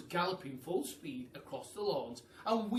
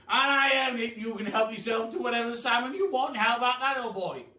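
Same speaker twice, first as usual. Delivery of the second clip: loud, grand aristocratic Duke, higher; booming Duke; 9 semitones higher, 12 dB louder than usual. Acted. The Duke welcomes the hungry giraffe, booming and grand. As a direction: boom it out like a jolly grand duke.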